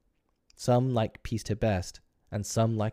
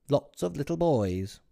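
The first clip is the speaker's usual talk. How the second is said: higher scornful sneer at the master; sneering maid; 7 semitones higher, 3 dB louder than usual. Acted. Maid Liza sneering at Mr Darling's crowd. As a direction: toss the words off with contempt. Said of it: "sneered Liza".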